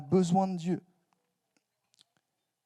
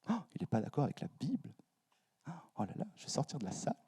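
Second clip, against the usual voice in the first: hushed, pretend scandalised listener; mock-shocked murmur; 10 dB quieter, faster than usual. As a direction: play a scandalised churchgoer under your breath. Voices shocked listeners' reaction.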